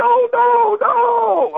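high, strained cry of no; high-pitched imitated scream of no; imitating a scream. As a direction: mimic a friend screaming in panic. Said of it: "I can hear him, like, screaming".

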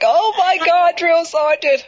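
high, strained complaining trainee; whiny trainee. Retold or imitated. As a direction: squeak out the trainee's whine. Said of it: "So they was like".

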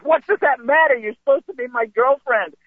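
put-on high-pitched, aggrieved boyfriend; mock indignant protest. Boyfriend to his cheating girlfriend, mock indignant.

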